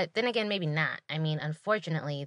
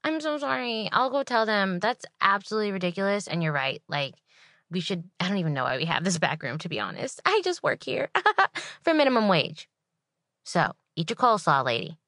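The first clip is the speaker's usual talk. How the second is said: fake sweet server; 3 semitones higher, 5 dB louder than usual. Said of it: "So I'm like".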